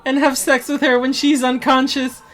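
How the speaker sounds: very high, loud, drawn-out whine; mock whiny outrage. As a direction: scold like a squeaky crybaby. Mocks Cosby's victim's friend whining.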